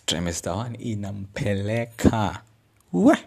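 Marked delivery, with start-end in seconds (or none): none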